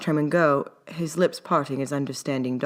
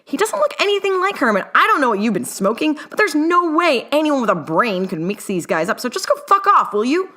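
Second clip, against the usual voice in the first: high furious shriek, much louder; 10 semitones higher, 4 dB louder than usual. A narrator's reading. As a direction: shriek at him in outrage. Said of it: "Newt shrieked".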